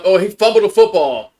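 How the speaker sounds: loud, sudden groan of dismay; dismayed outburst. Real, not acted. Fan watching on TV sees his quarterback fumble, dismayed outburst. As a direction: blurt it out as the ball comes loose.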